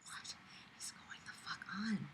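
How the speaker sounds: breathless whisper of disbelief; stunned whisper. Real, not acted. Reading a cringe story, he whispers in disbelief. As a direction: hush it like you can't believe your eyes.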